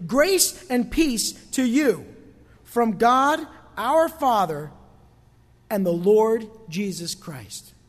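slow, weighty proclamation with long stresses; solemn scripture reading. Real, not acted. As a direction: proclaim the verse phrase by phrase, slowly. Preacher reads the verse out emphatically.

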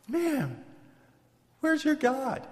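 high-pitched acted challenge; a scoffer's incredulous question; taunting doubter. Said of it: "they were telling him".

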